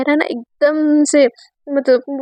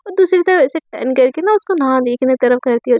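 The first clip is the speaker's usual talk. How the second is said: usual speaking voice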